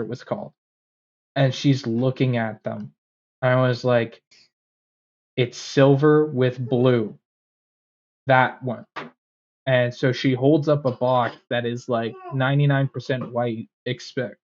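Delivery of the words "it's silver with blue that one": slow, spaced-out phrases; slow, deliberate explanation. Retold or imitated. Customer guiding the clerk to the right box, slow and deliberate. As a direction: point it out slowly and patiently.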